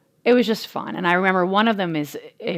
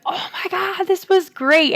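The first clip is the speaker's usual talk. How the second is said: high, bright burst of joy; excited kid quote; 11 semitones higher, slower than usual. Retold or imitated. Mom retelling son's joy, excited high quote. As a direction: mimic the kid's thrilled shout. Son's delight on downhill ride.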